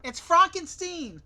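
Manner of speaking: high, loud, drawn-out correction; indignant mock shout. Character correcting how Frankenstein is said, indignant shout. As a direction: cry it out in comic indignation.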